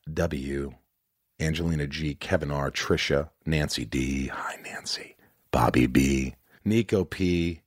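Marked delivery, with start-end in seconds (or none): 4.3-6.4 playful whispered aside to a patron; whispered names in a shout-out list. Real, not acted. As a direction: drop to a sudden whisper.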